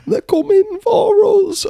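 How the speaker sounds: very high, drawn-out mock wail; mock tearful. Veteran tearing up over robot dogs, mock tearful wail. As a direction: imitate a man welling up, pitched right up. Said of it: "started to tear up".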